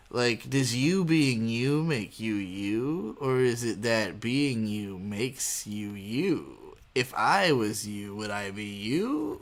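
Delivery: very slow, drawn-out musing; each word spun out slowly, mock profound; villain's musing. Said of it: "he just starts going on about".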